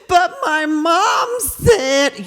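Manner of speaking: put-on high, slow, tearful child protest; crying little girl. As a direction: sob the words out like a hurt four-year-old. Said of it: "She starts crying".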